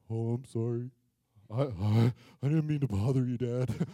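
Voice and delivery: very low, sheepish dog voice; deep, droopy doggy apology; insecure talking dog. Retold or imitated. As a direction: apologise awkwardly as a dejected dog. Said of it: "he's awkward and insecure".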